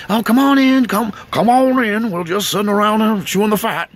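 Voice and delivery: loud hearty come-on-in voice; mimicking the brother's cheerful welcome.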